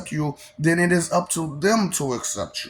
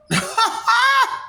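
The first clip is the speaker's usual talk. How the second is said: evil cackle. Acted. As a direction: let out a manic villain cackle. Clown persona cackling.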